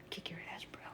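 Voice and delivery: whispered tough-guy taunt; whispered threat. Pretend. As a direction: mutter the threat under your breath. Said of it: "they're like".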